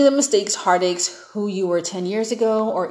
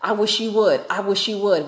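neck-rolling taunt; 4 dB louder than usual. Retold or imitated. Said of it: "all neck rolling in the man's face".

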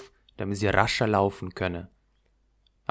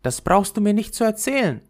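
put-on higher, quicker, impatient girl; a little girl snapping back, fast and high; Gerda, impatient; 9 semitones higher, 5 dB louder, faster than usual. A narrator's reading. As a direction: brush the flower off. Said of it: "sagte Gerda".

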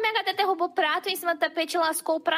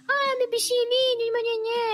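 high cooing pet voice, much higher; sweet baby talk; 10 semitones higher than usual. Shown as a demonstration. Owner sweet-talking her cat to show tone, high cooing baby talk. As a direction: coo lovingly to the kitty. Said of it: "Agora tu chega e fala assim".

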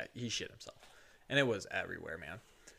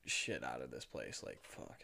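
normal talking voice

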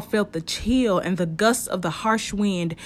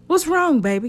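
alarmed question; 9 semitones higher, 4 dB louder than usual. Acted. Kia worried at Trey's reaction.

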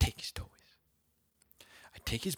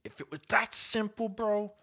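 comic exasperation; 12 semitones higher, 4 dB louder than usual. Real, not acted.

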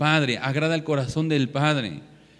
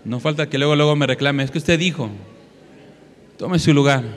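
louder, firm pulpit voice; forceful preaching; 7 dB louder than usual. Acted. Preacher telling the congregation to sit, loud and firm. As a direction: send them back to their seats firmly.

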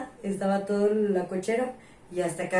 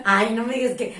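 ordinary talk, nothing marked

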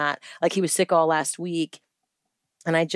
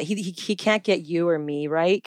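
usual speaking voice

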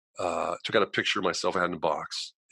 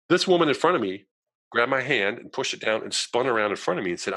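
normal talking voice

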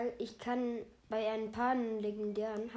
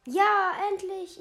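excited cheer; 9 semitones higher, 13 dB louder, slower than usual. Real, not acted. Awaited Pokémon finally arrives.

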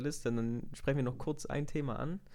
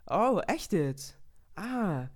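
slow, mildly higher mock interest; fake surprise; 7 semitones higher, much slower than usual. Faking interest to someone she begrudges. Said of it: "dann bin ich halt übel fake".

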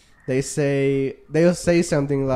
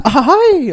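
high loud mock "ah, hi"; mock exclamation; 12 semitones higher, 9 dB louder than usual. Shown as a demonstration. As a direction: toss off a high shouted "ah hi". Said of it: "like that".

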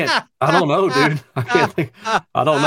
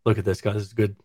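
lower, softer announcer voice; deep voiceover voice; 5 semitones lower, 7 dB quieter than usual. Pretend. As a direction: play it like a movie trailer narrator. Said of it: "Start a new voiceover career".

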